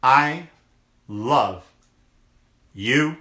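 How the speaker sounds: "I", "love", "you" each set apart by pauses; each word emphasized. Real, not acted. Host signing off to viewers, every word separately emphasized. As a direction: punch "I", "love", "you" one at a time.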